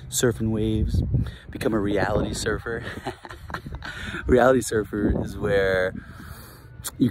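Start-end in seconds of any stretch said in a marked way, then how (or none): 2.9-4.2 short breathy chuckle; amused little laugh. Real, not acted. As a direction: chuckle briefly to yourself. Laughs after reality surfer phrase.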